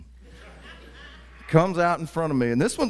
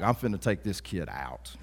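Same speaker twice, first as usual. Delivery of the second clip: ordinary delivery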